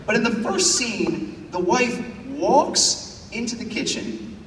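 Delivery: normal talking voice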